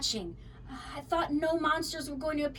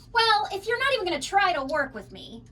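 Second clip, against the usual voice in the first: louder, higher whine; whined; 8 semitones higher, 6 dB louder than usual. A narrator's reading. Mac whines at the kid who rejects him, higher and louder. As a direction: sulk and complain. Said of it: "Mac whined".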